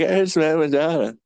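high-pitched, mock welling-up gush; a touch of tearful fangirl awe; retold teary awe. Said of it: "my eyes were welling up".

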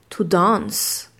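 to dance in British English; "to dance" with a long open a, "dahnce". Shown as a demonstration. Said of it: "écoutez bien la différence avec le A N". (en anglais britannique, "dance" avec un a long)

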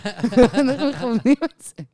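can barely get the words out for laughing; laughing through it. Retold or imitated. Repeating her dream line to friends, laughing hard.

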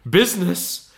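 high-pitched ghostly exclamation; indignant cry. A narrator's reading. Ghost rebuking Scrooge, crying out high. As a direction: throw the word back, high and appalled. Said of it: "cried the ghost".

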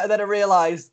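normal talking voice

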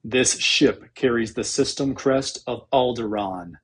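usual speaking voice

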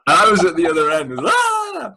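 can barely get it out for laughing. Real, not acted. Laughing while recounting the photo.

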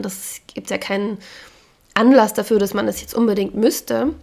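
normal talking voice

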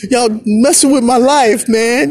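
high, pleading denial in a put-on voice; R. Kelly. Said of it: "This is what R. Kelly said".

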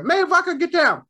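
high, loud yell to scold the cat. Retold or imitated. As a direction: shout her name to get her down. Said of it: "I yell at her".

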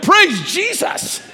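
high, slow, drawn-out exultant cry of relief; joyful praise. Retold or imitated. Pastor hearing the inspection found mold, exultant. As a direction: stretch it out like sudden relief.

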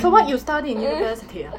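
blunt Hong Kong English question; Hong Kong accent. Shown as a demonstration. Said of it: "I do Hong Kong".

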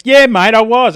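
indignant retort to the bloke; retold angry retort. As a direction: fire it straight back at him. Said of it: "I was pretty angry with the bloke".

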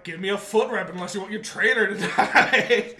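a hint of a mob-style threat; menacing Psyduck voice. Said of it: "Psyduck just being like".

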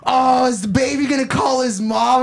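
high, loud mocking shout; retold family jeer. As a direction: jeer at him like he's a baby. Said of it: "they're like".

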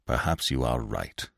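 low, soft, grudging growl. A narrator's reading. Binet grudgingly concedes to André Louis, low growl. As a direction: grumble it through your teeth. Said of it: "he growled".

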